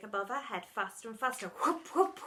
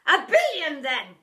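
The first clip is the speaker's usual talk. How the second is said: high, loud snap; snapped; 5 semitones higher, 14 dB louder, slower than usual. A narrator's reading. Deputy arguing with herself, a loud snap. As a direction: snap at yourself. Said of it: "she snapped back".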